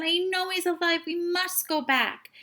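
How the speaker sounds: high, loud desperate cry; boy insisting through tears; grieving boy insisting his dog lives. A narrator's reading. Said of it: "Tim cried and cried".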